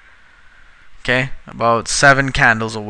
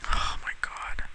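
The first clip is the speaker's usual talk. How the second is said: whispered "Oh my god" in despair; exasperated whisper; 14 dB quieter, slower than usual. Real, not acted. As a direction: whisper it like you're at the end of your rope. Fed up with the alert.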